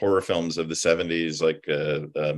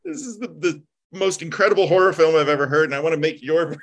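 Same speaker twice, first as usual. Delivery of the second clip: much higher, eager fanboy gush; gushing yes; 11 semitones higher, 4 dB louder than usual. Retold or imitated. Telling the hosts how he answered Herzog, high eager gush. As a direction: beg him like a starstruck fan. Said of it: "I'm like".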